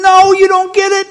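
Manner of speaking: hammed-up frustrated protest; mock exasperation. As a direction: plead with them to understand. Acting Jesus exasperated with the crowd.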